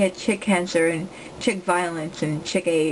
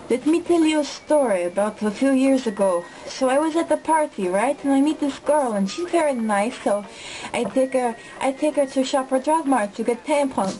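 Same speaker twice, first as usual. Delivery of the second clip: put-on higher-pitched accented storyteller, in character; put-on accent and raised pitch, telling it as the man himself; Ahmed; 5 semitones higher than usual. Classmate recounting the stolen PSP saga, in a higher accented impersonation. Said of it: "here's the story of Ahmed".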